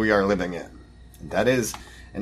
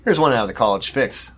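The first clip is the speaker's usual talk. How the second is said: ordinary delivery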